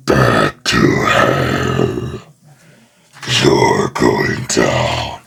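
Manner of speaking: very deep, very slow growl; Ghost Rider voice. Shown as a demonstration. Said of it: "it just sounds like Venom".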